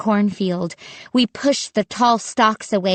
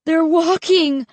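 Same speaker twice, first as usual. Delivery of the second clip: frightened cry; 8 semitones higher, 3 dB louder than usual. A narrator's reading. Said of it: "Mark cried".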